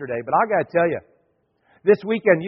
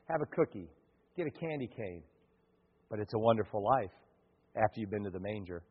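gentle guidance; 7 semitones lower, 8 dB quieter than usual. Retold or imitated. Gently guiding the hesitant lady.

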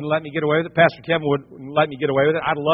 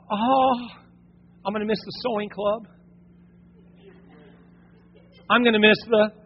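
wistful wife; 5 semitones higher than usual. Acted. Imitating Lot's wife's longing.

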